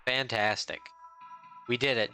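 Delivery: ordinary delivery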